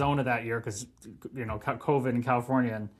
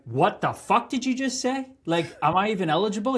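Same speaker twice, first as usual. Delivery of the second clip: disbelief; 6 semitones higher than usual. Retold or imitated. Disbelief at draft news.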